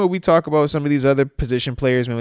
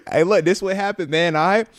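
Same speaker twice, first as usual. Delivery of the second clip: ordinary talk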